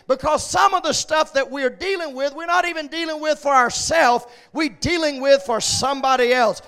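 ordinary talk

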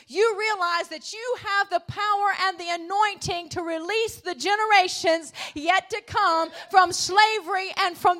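loud, rising preacher's cry; full-force preaching. Acted. Pastor stirring the church to rescue the next generation, shouting. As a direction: drive it home to the congregation.

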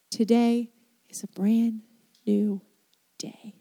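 hushed, breathy whisper of the promise. Shown as a demonstration. Preacher to his congregation, in a whisper. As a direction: drop to a bare whisper. Preacher repeats the line softly.

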